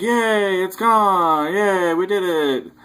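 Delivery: high-pitched imitated victory cheer; mock cheering. Acting out the group's victory cheer.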